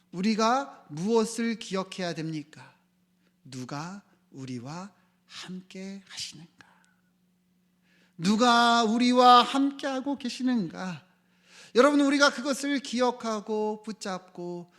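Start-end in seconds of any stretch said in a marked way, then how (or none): 3.4-6.7 soft, slow, spaced-out question; much softer, deliberate asking; quiet question. Real, not acted. Preacher asks softly, then repeats forcefully. 8.2-11.0 loud, slow, raised preaching cry; forceful, high, stretched-out question; emphatic question. Real, not acted. Forceful repeat of the soft question.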